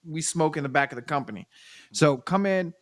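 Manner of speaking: ordinary talk, nothing marked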